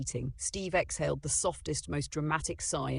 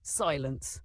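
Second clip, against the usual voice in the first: ordinary delivery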